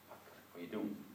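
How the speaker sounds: whispered, intimate question; soft whisper. Retold or imitated. Jesus quietly asking the blind man, whispered. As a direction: breathe it softly. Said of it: "moet je je voorstellen".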